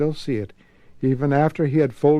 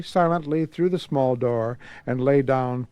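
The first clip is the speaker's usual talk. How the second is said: ordinary delivery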